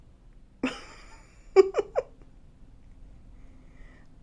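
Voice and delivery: breathy high burst of laughter. Real, not acted. Owner laughing at her eye-rolling bird.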